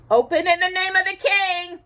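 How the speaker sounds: high, loud herald's call; booming. A narrator's reading. Messenger at Cinderella's door, booming loud. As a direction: bellow it through the door. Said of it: "the voice boomed".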